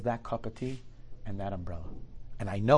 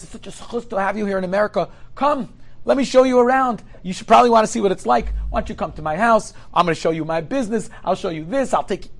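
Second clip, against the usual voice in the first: higher and louder, bubbling with pride; eager host; 9 semitones higher, 8 dB louder than usual. Acted. Wealthy American host inviting the visitor home, eager and loud. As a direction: sell him on your whole world.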